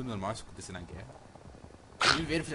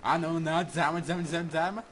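raging gamer; 6 semitones higher, 6 dB louder than usual. Real, not acted. Panicked callout mid-fight.

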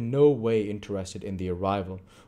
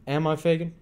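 Chitling voice; 8 semitones higher, 5 dB louder than usual. A narrator's reading.

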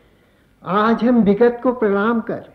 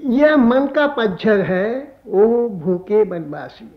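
poem recitation; 4 semitones higher than usual. Acted. Poet presenting new songs to listeners, lilting poem recitation. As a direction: recite verse, repeating lines with swelling pitch.

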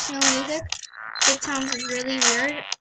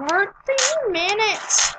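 slow drawn-out; 8 semitones higher, 4 dB louder, much slower than usual. Real, not acted. A girl reacting to her own video, slow and higher. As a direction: draw out Thirteen minutes slowly and higher. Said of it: "Thirteen minutes I've been recording".

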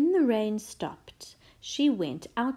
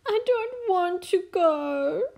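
put-on high, loud, tearful child's whine; sobbing princess; 10 semitones higher, 5 dB louder than usual. A narrator's reading. Princess refusing the sleepover, sobbing to mum. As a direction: wail it as a child in tears. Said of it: "sobbed the little princess".

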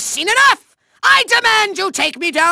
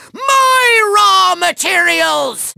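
slow, drawn-out cry of outrage; hammed-up villain anguish; outraged wail; 3 semitones higher, much slower than usual. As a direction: wail over your stolen treasure. Villain outraged at stolen materials.

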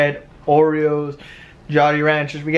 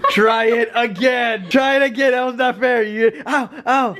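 laughing, high and rising to a yell; 8 semitones higher than usual. Real, not acted. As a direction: crack up while pushing them. Laughing, urging sibling to retry.

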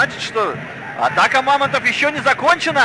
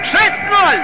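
excited shout; 6 semitones higher than usual. Real, not acted. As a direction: call out the final score. Final score exclamation. (радостный выкрик)

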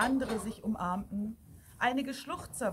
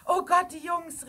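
high, loud exclamation of shock; woman's horrified call; alarmed cry; 8 semitones higher, 7 dB louder than usual. A narrator's reading. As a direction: cry out for the boys in peril. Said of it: "rief eine Frau".

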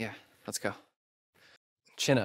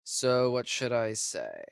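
ordinary delivery

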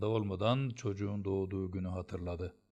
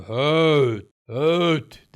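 roaring, much louder and higher; furious shout; 6 semitones higher, 14 dB louder than usual. A narrator's reading. Teacher roars at the boy in rage. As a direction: bellow at the boy to shoo him. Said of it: "diye bağırdı".

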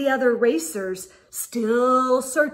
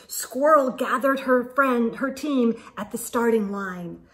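usual speaking voice

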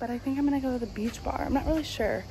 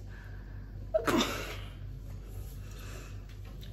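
breathy laugh; 10 dB quieter than usual. Real, not acted. Laughing at the taxi story.

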